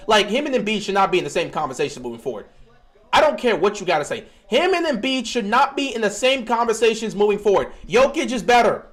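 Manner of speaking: usual speaking voice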